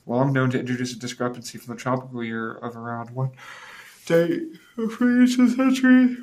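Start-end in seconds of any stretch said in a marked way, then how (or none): 4.1-6.2 high squeaky voice. Pretend. Reading suddenly in odd voice.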